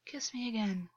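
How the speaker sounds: soft, gentle coaxing. A narrator's reading. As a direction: lure him in softly. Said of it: "August coaxed".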